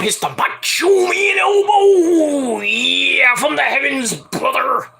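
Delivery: high, long-drawn cry on the move; hammed-up wrestler hype, stretched high; hyped wrestling call. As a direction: howl it out slowly like a wrestling promo. Hypes elbow drop in wrestler's style.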